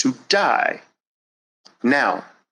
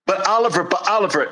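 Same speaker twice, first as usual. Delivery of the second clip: high, pleading whine; mock protest, high and nasal; whiny excuse; 6 semitones higher, much faster than usual. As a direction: whine like a stalling child. Whiny objector in fire scene.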